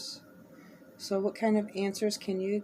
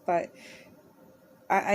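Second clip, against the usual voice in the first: usual speaking voice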